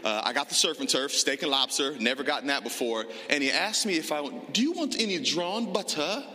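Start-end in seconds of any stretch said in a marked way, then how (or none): 4.5-6.2 mildly imitated waiter's accent, a little higher; a light imitation of a fancy New York waiter's accent. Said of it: "he said it with the accent".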